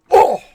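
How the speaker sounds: loud high oof; winded gasp. Retold or imitated. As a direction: blast out the impact. Getting winded by cat.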